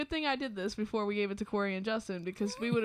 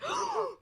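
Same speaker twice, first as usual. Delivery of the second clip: high, sharp shocked gasp; imitated panicked intake of breath; retold gasping. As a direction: gasp as if in horror. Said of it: "gasping repeatedly".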